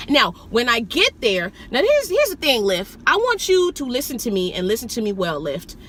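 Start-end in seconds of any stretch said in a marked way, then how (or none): none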